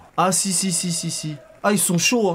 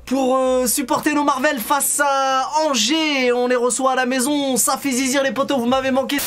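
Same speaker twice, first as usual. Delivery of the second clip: hyped show host; 5 semitones higher than usual. Acted. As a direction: greet the viewers with big energy. Live show host greeting.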